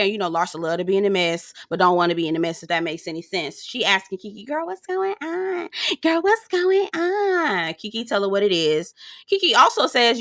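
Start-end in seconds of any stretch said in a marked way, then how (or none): 4.4-7.7 high, drawn-out mock questioning; nosy friend impression. Voicing Larsa asking for gossip.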